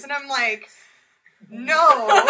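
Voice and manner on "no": loud laughing no. Real, not acted. Denying the tortoise to the delivery gal, laughing loudly. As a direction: blurt a laughing denial.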